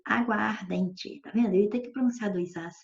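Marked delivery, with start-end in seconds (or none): 0.1-1.2 slow "água ardente" with both As sounded separately; two As pronounced. Shown as a demonstration. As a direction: say "água" then "ardente", keeping each A distinct. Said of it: "eu ia ter que pronunciar água ardente".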